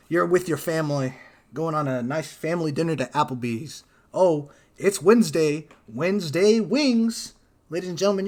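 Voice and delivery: hammed-up announcer pitch; mock ad read. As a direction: sell it like a commercial.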